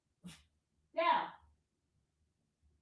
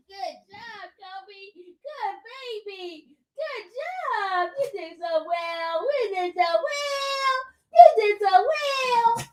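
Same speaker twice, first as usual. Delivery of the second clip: soft, sing-song pet praise; cooing praise; 4 semitones higher, 5 dB quieter than usual. Real, not acted. Owner tells the dog he did well, cooing praise. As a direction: coo softly, lifting higher as the praise goes on.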